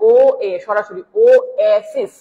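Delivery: ওয়েসিস broken slowly into ও, এ, then the rest; syllable-by-syllable demo. Shown as a demonstration. Teacher breaking down a word for students, slow split ওয়েসিস. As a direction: spell out the opening vowels of ওয়েসিস, slowly. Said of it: "এই যে এরকম উচ্চারণটা".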